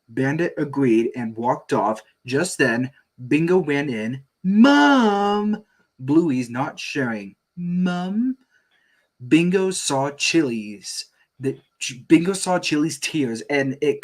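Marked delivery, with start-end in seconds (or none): 4.4-8.4 put-on high, loud kid call; child calling out. Bingo calls out.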